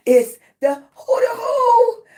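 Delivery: high, loud squawking cry; chicken-like squawk. Real, not acted. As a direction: crow it out like a rooster. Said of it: "I sound like a chicken".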